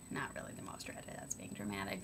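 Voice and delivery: unvoiced whisper, taking it back; half-whispered self-correction; whispering a quick correction to herself; whispered aside. Real, not acted.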